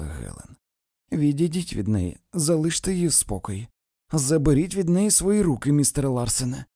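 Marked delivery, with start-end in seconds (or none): 1.1-6.6 raised, high commanding voice; sharp order. A narrator's reading. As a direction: order someone off loudly. Helen orders him away from her. (різкий наказ)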